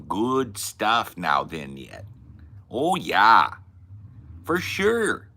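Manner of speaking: drawn-out, singsong Midwestern vowels; Midwest accent. Acted. Mocking the annoying Midwest shit, Midwest accent. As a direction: stretch it into an exaggerated Midwest drawl. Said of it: "that fucking Midwest shit".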